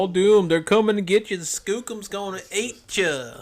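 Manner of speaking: loud, high, ranting doom warning; doomsayer shout. Retold or imitated. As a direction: rave like a crazy old prophet. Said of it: "typical doomsayer".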